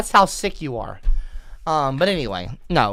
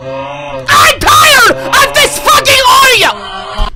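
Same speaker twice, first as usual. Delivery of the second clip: furious scream; 12 semitones higher, 8 dB louder than usual. Real, not acted. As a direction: scream it out in real rage. Streamer screams at donation audio.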